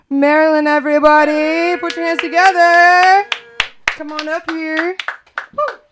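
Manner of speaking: loud, high host announcement; big emcee intro; announcer call. Real, not acted. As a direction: hype her up to the room. Host introduces next performer.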